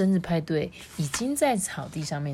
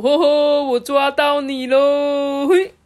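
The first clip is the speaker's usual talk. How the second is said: loud, high gotcha with long end; triumphant doctor character; 9 semitones higher, 8 dB louder, slower than usual. A narrator's reading. Doctor catching someone blindfolded, loud triumphant singsong. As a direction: crow slowly in singsong triumph. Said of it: "布姆博士就说".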